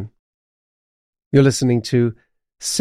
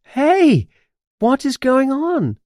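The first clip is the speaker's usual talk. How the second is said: high-pitched, alarmed call; acted shout; 12 semitones higher than usual. A narrator's reading. Witch upside down on a broom, high shout. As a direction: cry out in high indignation. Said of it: "Simma shouts".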